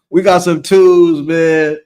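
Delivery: loud, slow, dragged out in amused disbelief; mock disbelief. Real, not acted. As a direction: lean in hard on twos, slow and loud. Repeats the rating after laughing.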